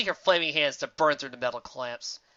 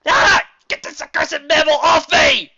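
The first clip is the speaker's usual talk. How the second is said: high, loud villain shriek; cartoon villain under attack; panicked yell; 13 semitones higher, 15 dB louder, slower than usual. A narrator's reading. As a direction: yell it slowly in outrage. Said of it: "he yelled".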